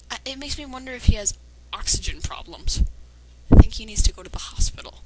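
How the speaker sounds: ordinary delivery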